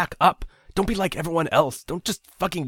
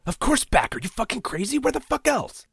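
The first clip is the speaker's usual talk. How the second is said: incredulous anger; 4 dB louder than usual. A narrator's reading.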